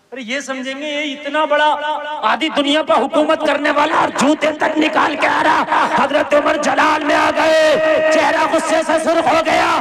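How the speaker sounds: high, loud sermon cry of equality; fiery preaching. Acted. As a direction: proclaim it to the whole crowd at full force. Preaching at full force.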